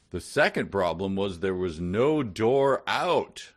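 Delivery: loud, slow, solemn reading; dramatic reading. Shown as a demonstration. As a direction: read it like a grave narrator.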